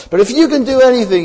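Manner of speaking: high, loud pleading father; earnest desperate plea; innocent plea. Retold or imitated. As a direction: beg Jesus in all innocence. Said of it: "he says this all, in all innocence".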